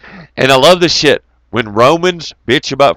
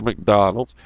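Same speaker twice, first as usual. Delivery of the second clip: usual speaking voice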